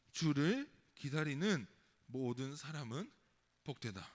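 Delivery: slow, measured group-reading voice; deliberate, drawn-out scripture reading; slow recitation. Acted. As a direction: lead a congregation through the verse slowly, falling low at the end. Leads congregation reading verse aloud.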